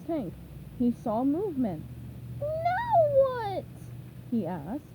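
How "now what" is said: squeaky sponge voice. A narrator's reading. SpongeBob spots movement.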